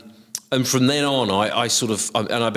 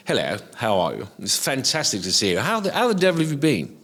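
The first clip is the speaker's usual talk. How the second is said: a hint of a plummy upper-class greeter, rising high on the last line; mock posh greeting; 4 semitones higher than usual. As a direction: lightly send up a posh gent greeting old friends. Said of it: "some extreme examples there".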